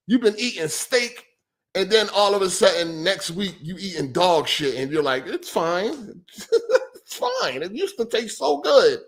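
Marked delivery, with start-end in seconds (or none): 5.3-9.0 high, laughing mock voice pretending it's fine; hammed-up fake contentment, pitched up with a laugh; mock contented gamer. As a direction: put on a forced-cheerful high voice, laughing it off. Mocking someone settling for worse.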